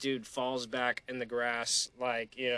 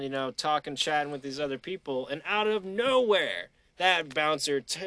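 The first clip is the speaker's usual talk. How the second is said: usual speaking voice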